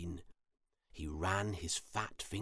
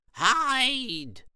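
high, loud drawn-out warning cry; 12 dB louder than usual. A narrator's reading. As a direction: shout the warning high and long. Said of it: "she cried".

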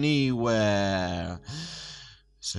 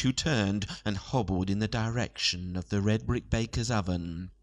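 ordinary delivery

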